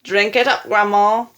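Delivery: loud, gleeful egging on; grinning. A narrator's reading. Man egging grandma on to drink, grinning cheer. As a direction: encourage her with a big grin. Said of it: "grinning hugely".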